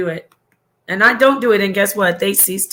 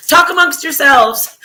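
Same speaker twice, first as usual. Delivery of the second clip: higher, louder mock-choked-up sketch voice; SNL sketch character; 6 semitones higher, 8 dB louder than usual. Streamer getting emotional quotes a comedy sketch to viewers, hammed-up impression. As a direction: imitate an emotional TV host, pushed up and loud. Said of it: "Saturday Night Live clip".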